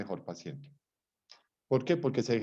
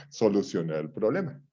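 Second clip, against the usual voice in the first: ordinary talk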